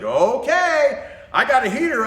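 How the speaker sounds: breezy upbeat worker; chatty cashier. Retold or imitated. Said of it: "he's like".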